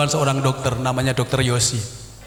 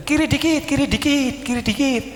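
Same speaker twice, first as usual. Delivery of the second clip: higher-pitched imitation of a woman giving directions; doctor's wife directing; 8 semitones higher than usual. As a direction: call out quick repeated instructions. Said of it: "Istrinya bilang gini".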